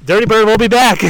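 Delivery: loud, high call out; excited shout. Real, not acted. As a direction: call it out over the rain. Rushing off as rain hits.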